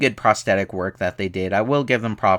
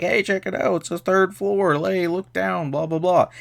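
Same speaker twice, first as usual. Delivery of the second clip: high mock female character voice; imitating Liz's chatter; 7 semitones higher than usual. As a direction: put on a high voice, rattling off her lines. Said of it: "Liz walks up it later and is like".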